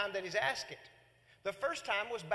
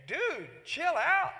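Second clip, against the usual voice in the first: higher and louder, calling out Dude; hammed-up chill out; exasperated reply to a sulking prophet; mock exasperated shout; 8 semitones higher, 7 dB louder, much slower than usual. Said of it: "you think".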